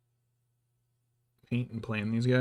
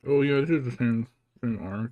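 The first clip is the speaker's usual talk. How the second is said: ordinary talk, nothing marked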